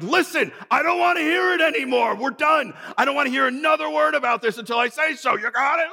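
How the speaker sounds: the real blow-up, hammed-up high shouting; angry shout. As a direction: blow up and lay down the law. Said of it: "that's not how I dealt with it".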